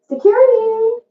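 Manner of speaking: high mock call for security. Empress shooing a suitor, calls out high for security. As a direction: pitch it way up, summoning the guard.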